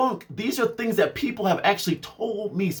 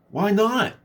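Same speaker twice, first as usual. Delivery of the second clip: mock boss; 3 semitones higher than usual.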